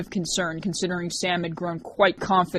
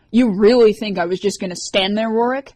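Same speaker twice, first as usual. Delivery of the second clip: hissing; 4 semitones higher, 7 dB louder than usual. A narrator's reading. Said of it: "she hissed".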